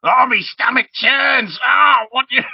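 high, loud, slow groaning goblin. Acted. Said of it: "making all the noises of all the goblins".

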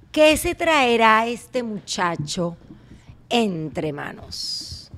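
very slow, drawn-out wondering; mock suspense. Host filling time while guest writes, slow mock suspense.

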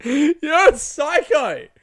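loud, high-pitched, drawn-out exclamation; retold outburst. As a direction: blurt it loud and high, stretching the words. Retelling his reaction to the other comic.

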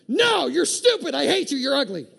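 hammed-up secret fury with a laugh; inner rage voice. Preacher acts out the passive-aggressive inner voice, mock shrieking. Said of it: "But inside you're like".

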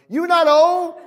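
high, punchy preacher's cry; emphatic rebuke. Real, not acted. Preacher answering people who complain at thirty, emphatic rebuke.

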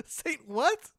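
high, squeaky laughing question; laughing disbelief. Real, not acted. Player hearing a silly name, incredulous and laughing. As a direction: crack up while asking.

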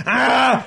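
high shouted roar with bared teeth; beastly roar. Retold or imitated. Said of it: "bared his teeth and was like".

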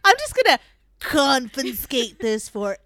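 hammed-up sneaky official; sly Silent Brother. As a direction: pretend it's official business.

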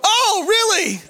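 high, laughing "oh really"; mock surprise. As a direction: act caught off guard, half laughing. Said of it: "It's a surprise to me".

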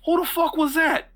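high, loud outraged yell; muddy townsman yelling in anger; angry shout. Acted. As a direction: shout it at whoever knocked you down.

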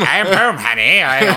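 put-on loud, high old Hollywood transatlantic accent; over-the-top transatlantic accent. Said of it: "the transatlantic accent".

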